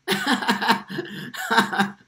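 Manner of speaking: high, loud burst of laughter; big cackling outburst. Real, not acted. Scoffing at people's behavior.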